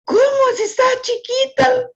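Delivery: high disbelieving gasp of a question; retold shock. Grandmother retells her shock at the tiny girl being spanked, high disbelief. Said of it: "Y yo me quedé así como que".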